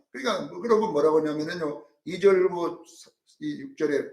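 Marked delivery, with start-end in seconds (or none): none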